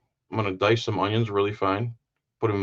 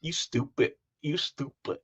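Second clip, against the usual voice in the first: higher, softer imitated lady; pool hall lady; 3 semitones higher, 8 dB quieter than usual. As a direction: imitate her dropping the final D. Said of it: "there's a lady there".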